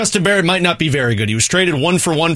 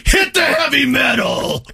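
laughing shout; 11 semitones higher, slower than usual. Pretend. Mocking producer cue.

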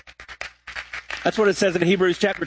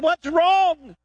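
alarmed dad; 11 semitones higher than usual. Acted.

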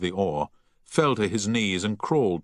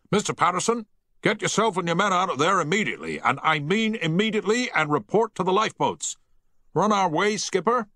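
urgent bellow, much higher, fast at first; bellowed order; 11 semitones higher than usual. A narrator's reading. Captain orders Patterson out of the engine room, bellowing. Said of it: "Havens bellowed down the voice pipe".

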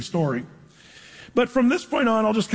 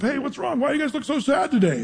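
higher-pitched, lightly acted concerned question; concerned friendly greeting; 7 semitones higher than usual. Joseph asks two troubled prisoners, lightly acted friendly concern. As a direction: check lightly on downcast companions.

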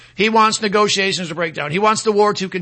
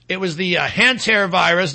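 ordinary delivery

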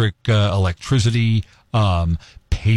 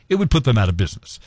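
ordinary talk, nothing marked

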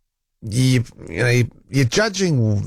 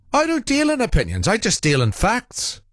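lightly voiced pompous caller, high; a hint of Irish John's lofty tone; mimicking John; 12 semitones higher than usual. Host mocking a caller's catchphrase, lightly high. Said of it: "I can't do his accent".

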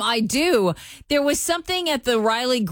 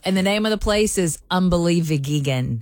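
normal talking voice

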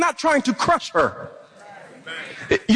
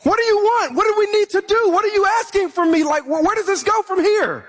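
higher, exasperated questioning; a grieving son demanding answers; frustrated at God; 6 semitones higher, faster than usual. Retold or imitated. Grieving son after his mom died, exasperated. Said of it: "I was frustrated".

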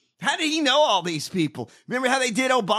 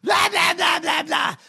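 mock the boring speech; mocking blah blah; 7 semitones higher, slower than usual. Mocking a dull politician's statement.

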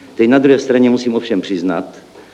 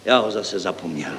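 subdued, softer admission; crestfallen; 3 semitones lower, 8 dB quieter than usual. Acted. Deputy referent lost his idea, crestfallen. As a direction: let it drop, deflated. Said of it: "Sesmutnil zástupce stavebního referenta".

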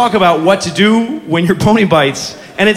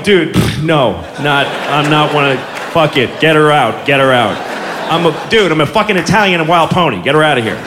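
pony's voice; 6 semitones lower than usual. Retold or imitated. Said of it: "it's totally going".

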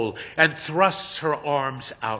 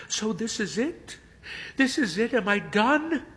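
despairing disbelief; 9 semitones higher than usual. A narrator's reading. Hermione despairs as viewers leave.

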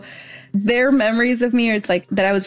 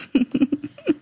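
fond laugh; 6 semitones higher than usual. Real, not acted. Sister remembering little brother's first kindergarten day, fond laugh. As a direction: giggle to yourself, rising at the end.

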